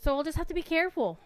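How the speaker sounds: fast hammy warning voice; mock dramatic voice. Sudden fast high line.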